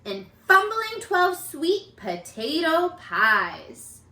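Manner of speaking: put-on lively storybook reading for kids; animated picture book narration; read-aloud narration. A narrator's reading.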